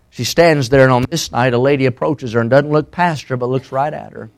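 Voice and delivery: low, soft narration; hushed sermon storytelling. Real, not acted. Preacher painting a street corner encounter, hushed storytelling.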